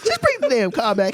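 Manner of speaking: high pleading squeak; squeaky skit line. Pretend. Pleading to Kendrick in a high squeak.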